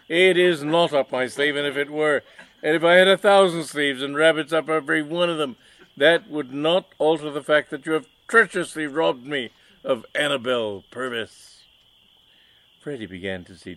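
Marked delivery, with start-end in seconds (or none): 0.1-11.6 loud, high, indignant villain; mustache twirling villain. Acted. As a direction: rant at the rival, outraged. Villain denies trick, accuses.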